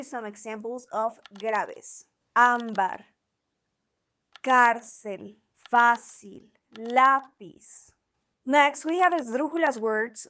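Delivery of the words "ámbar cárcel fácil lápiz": Ámbar, cárcel, fácil, lápiz, each hit louder on the first syllable; stress on penultimate syllable. Shown as a demonstration. Teacher giving examples of graves, Ámbar, cárcel, fácil, lápiz stressed on first syllables. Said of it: "stress on the penultimate syllable".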